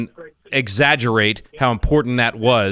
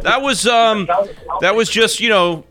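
mock denial; 10 semitones higher, slower than usual. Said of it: "Okay, I was crying".

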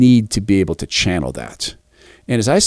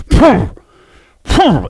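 imitated lion purr; 11 semitones higher, 5 dB louder than usual.

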